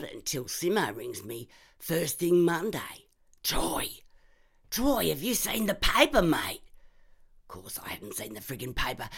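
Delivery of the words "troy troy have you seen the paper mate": high, urgent, frightened caller; panicked mate on the phone; scared phone call. Acted. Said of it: "he sounds cactus".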